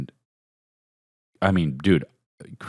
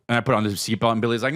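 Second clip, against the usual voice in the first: ordinary delivery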